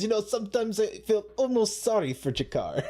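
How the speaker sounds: high, pseudo-Russian Londo voice; pseudo-Russian accent. Retold or imitated. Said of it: "You're getting pretty good with that, uh, accent".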